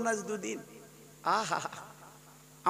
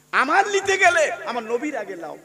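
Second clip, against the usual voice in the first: rising louder and higher; building sermon climax; 7 semitones higher, 9 dB louder than usual. Real, not acted. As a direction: drive the point home to the crowd.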